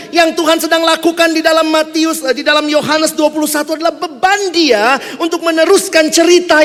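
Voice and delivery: loud, high preaching climb; rousing sermon peak; full-force preaching. Acted. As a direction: preach it out to the whole hall.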